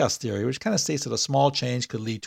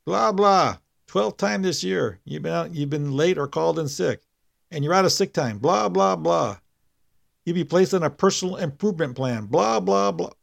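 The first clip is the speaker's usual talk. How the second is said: higher-pitched mock boss speech; mocking recital; 6 semitones higher than usual. A narrator's reading. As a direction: drone off the boss's lecture dismissively, higher. Mockingly recites the boss's warning speech.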